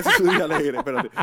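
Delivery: bursting into high laughter mid-phrase; laughing. Real, not acted. Repeating the joke while laughing.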